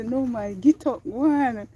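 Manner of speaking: urging herself up the hill; self pep talk. Retold or imitated. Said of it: "And I say".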